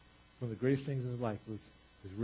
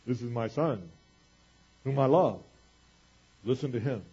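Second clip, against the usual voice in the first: solemn booming verse reading, lightly done; booming voice from heaven; 7 dB louder than usual. Acted. God speaking from heaven as usually read, mildly booming. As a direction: read it as a grand declaration, stressing love. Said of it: "Hear this booming voice from heaven".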